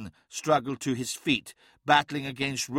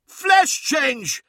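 very high, loud alarm shout; 8 dB louder than usual. A narrator's reading. Araman warning the others of the change, alarm shout. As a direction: cry the warning with all you have. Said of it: "shouted Araman".

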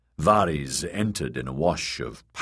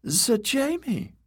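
high, slow, breathless eunuch voice; panting eunuch; slower than usual. A narrator's reading. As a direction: gasp his name up high, caught by the knife. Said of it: "Varys panted".

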